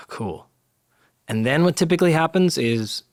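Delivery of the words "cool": deflated. Shown as a demonstration. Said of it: "It is".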